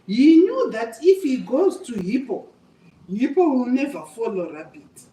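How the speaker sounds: ordinary delivery, nothing marked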